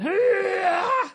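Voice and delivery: hammed-up effort yell; strained squat grunt. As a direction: mimic the last rep of a squat set. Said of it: "sounding like you're doing a squat".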